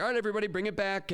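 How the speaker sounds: high, quick call to a crowd; calling a room back. Retold or imitated. Presenter regaining teachers' attention, high quick call-out. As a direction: hurriedly round everyone up. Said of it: "and I'm like".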